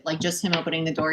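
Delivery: normal talking voice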